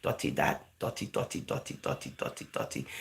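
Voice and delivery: whispered, low mockery of naysayers, fading away; mocking critics. Vlogger mimicking people who call weed dirty, whispered mockery. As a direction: hiss their "dotty" talk back at them under the breath. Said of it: "you got people saying the same thing".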